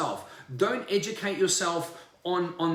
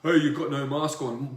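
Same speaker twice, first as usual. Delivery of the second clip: lightly voiced nagging busybody; a hint of a scolding busybody, lower; mock nagging; 4 semitones lower, 3 dB louder than usual. Mimicking someone scolding people about masks, mild mock nag. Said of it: "I'm not gonna go".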